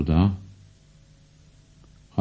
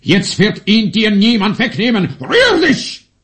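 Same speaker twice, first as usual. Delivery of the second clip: higher and louder, barking orders in agitation; worked up, commanding; 7 semitones higher, 6 dB louder than usual. Acted. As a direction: snap the command out, pitch climbing. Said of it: "Ich höre neue Noten in deiner Stimme". (aufgeregt und befehlend, lauter und höher)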